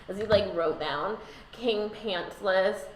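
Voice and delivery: ordinary talk, nothing marked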